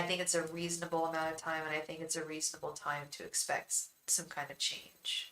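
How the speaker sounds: blocked nose, voice fading; stuffed up. Real, not acted. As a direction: talk through a stuffed-up nose. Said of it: "Why do I sound so stuffed up?".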